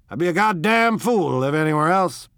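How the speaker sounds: loud, brash and high; bluff man's voice. Acted. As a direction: brag it loudly. Tom's emphatic voice.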